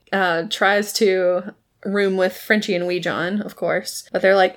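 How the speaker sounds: ordinary talk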